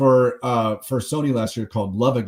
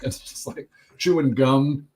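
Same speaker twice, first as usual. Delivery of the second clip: ordinary delivery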